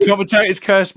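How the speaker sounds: loud, high, playful declaration; excited call. Real, not acted. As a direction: announce it with a grin. Jokes after the pass is lost.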